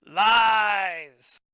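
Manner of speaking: huge booming shout; dopey cartoon bellow. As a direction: bellow it like a dumb brute. Guardian shouts accusation.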